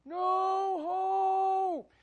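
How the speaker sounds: high, loud wail of no hope; high-pitched shouting lament; despairing old man. Retold or imitated. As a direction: cry out like a caged old man who gave up. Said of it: "he's like".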